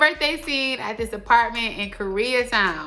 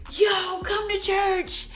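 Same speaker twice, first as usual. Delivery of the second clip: retold yelling; 11 semitones higher, slower than usual. Stranger calling a passerby to church, mock yelling. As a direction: imitate someone hollering from a car window. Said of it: "he starts yelling out of his window".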